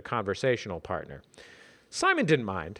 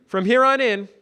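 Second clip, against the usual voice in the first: high, loud insistent voice; emphatic; 11 semitones higher, 8 dB louder than usual. Acted. Simon emphatic.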